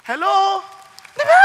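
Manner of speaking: usual speaking voice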